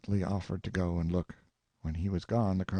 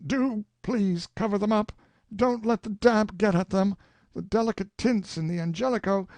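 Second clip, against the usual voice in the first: anguished shout; 12 semitones higher than usual. Acted. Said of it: "shouted with an anguished accent".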